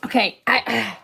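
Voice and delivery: normal talking voice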